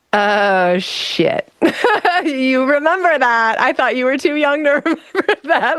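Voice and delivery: nervous laugh of a mother caught off guard; embarrassed laugh. Retold or imitated.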